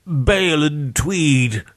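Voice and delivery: slow, high, snuffled naming; snuffling victim. A narrator's reading. Beaten victim naming the dead to their killer, snuffling. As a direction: snuffle the names out pitifully, slowly. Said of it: "Charles snuffled".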